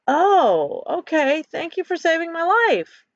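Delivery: surprised. A narrator's reading. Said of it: "Ken, surprised, responds".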